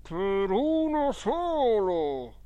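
high, very slow ghost voice; fake spirit. A narrator's reading. Hidden boy answering as the dead uncle, ghostly drawl. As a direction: draw the words out like a haunting spirit. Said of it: "risposi io".

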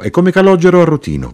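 high, loud, quick insistence; elenco enfatico. A narrator's reading. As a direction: press the point, pitched high and fast. Ezechiele elenca con enfasi. (incalzante, acuto e forte)

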